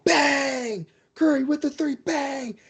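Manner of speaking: high, punchy sportscaster impression; bang call. Commentator demonstrating the famous bang call, high-pitched announcer shout. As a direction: imitate a basketball announcer's big exclamation. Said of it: "There's the bang call".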